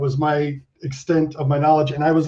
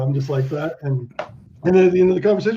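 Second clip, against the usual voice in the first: normal talking voice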